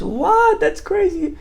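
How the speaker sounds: high-pitched mock astonishment; amazed shout. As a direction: voice jumps way up in disbelief. Buyer amazed at talking parrot.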